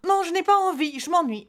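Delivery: high-pitched whining refusal; enfant boudeur. Acted. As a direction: whine it like a bored child. Enfant refuse de jouer seul. (voix aiguë d'enfant qui râle)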